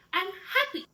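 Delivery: high, cheerful declaration; happy. Shown as a demonstration. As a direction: show happiness with a lifted pitch. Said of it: "your facial expression has to show".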